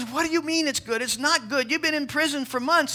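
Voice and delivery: high, disbelieving question; incredulous. Retold or imitated. Landowner baffled at servant's thanks, high and incredulous.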